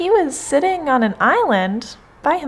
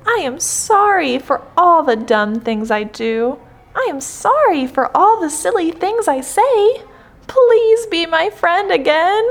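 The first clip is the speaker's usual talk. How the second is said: apologetic plea, climbing higher; crying out; 6 semitones higher than usual. A narrator's reading. Toad begging Frog to be friends again, pleading. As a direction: plead with a friend, building in pitch. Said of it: "cried Toad".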